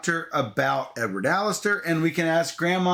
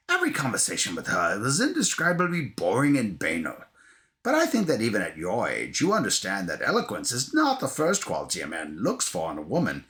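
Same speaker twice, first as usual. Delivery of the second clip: Maggie Smith; 5 semitones lower than usual. Acted. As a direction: lower old English lady voice. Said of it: "It's Maggie Smith, okay?".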